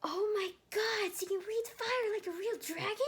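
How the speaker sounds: put-on high, loud starstruck boy; excited. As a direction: gush like an amazed fan. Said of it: "large with excitement".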